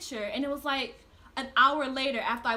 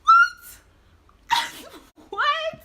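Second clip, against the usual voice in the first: very high, loud disbelieving shriek; shocked outburst; 13 semitones higher, 14 dB louder, much slower than usual. Real, not acted. Learning his real age, shrieking in disbelief. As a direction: scream it in total disbelief. Hearing he's twenty.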